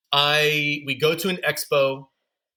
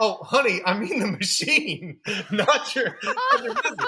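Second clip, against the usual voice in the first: kind nurse laughing; 7 semitones higher than usual. Retold or imitated. Nurse voiced, laughing at misunderstanding.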